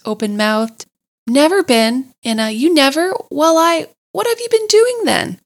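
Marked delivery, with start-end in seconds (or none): 1.3-5.4 rising, louder stammering amazement; flustered disbelief. A narrator's reading. Rat baffled the mole never boated, sputtering.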